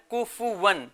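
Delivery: کفون in three syllables, ku-fu-wan; vowel kept after the f in کفون; original pronunciation. Shown as a demonstration. Teacher models for children, کفون as ku-fu-wan. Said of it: "اصل تلفظ کیا ہے؟".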